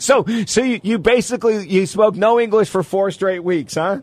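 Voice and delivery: laughing while asking. Real, not acted. Host laughing as he asks the guest about Germany, amused. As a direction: laugh your way through the question.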